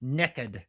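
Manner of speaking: "Naked" said as "nekkid"; Southern pronunciation. Shown as a demonstration. Repeating the Southern word for the listener, Southern pronunciation. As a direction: say "Naked" with the vowel of "neck". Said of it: "we say naked".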